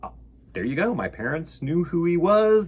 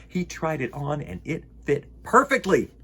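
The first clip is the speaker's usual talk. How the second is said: plain reading voice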